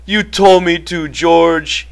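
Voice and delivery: high, miserable protest. A narrator's reading. Lenny, cowering, excuses himself to George, miserably. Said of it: "he said miserably".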